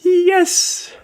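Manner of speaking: high, delighted squealing laugh; real delight laughing while speaking. Real, not acted. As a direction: laugh with glee at the match. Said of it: "delighted at his own line".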